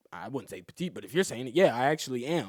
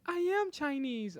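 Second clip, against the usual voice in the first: high, drawn-out protesting imitation; high-pitched mock affront; mock indignation; 14 semitones higher, 5 dB quieter, slower than usual. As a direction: imitate her correcting you, slow and high. Mimics girl's indignant correction.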